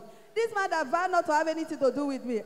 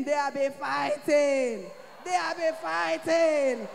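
slow, louder emphatic refrain; preaching shout; 2 semitones higher, 3 dB louder, slower than usual. Real, not acted. Telling the church about husband and his lady, slow shouted refrain. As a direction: hammer it home to the congregation. Testimony climax, repeated over music.

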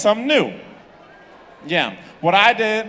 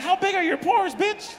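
much higher, mocking outrage; mock disgusted squeal; 11 semitones higher than usual. Comic imitating himself grossed out by his wife. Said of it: "You're just like".

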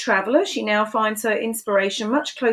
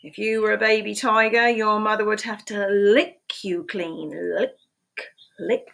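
lively picture-book read-aloud, questions pitched high; animated storytime voice with playful questions; reading to children; 6 semitones higher, slower than usual. Acted. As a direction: read it like storytime for little ones.

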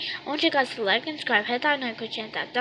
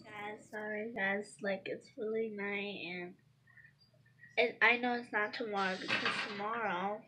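quiet; 3 semitones lower, 10 dB quieter than usual. Real, not acted. Late at night, hushed bathroom talk.

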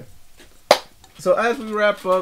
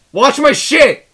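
mock shout; 6 semitones higher, 8 dB louder, slower than usual. Mock tough guy warning.

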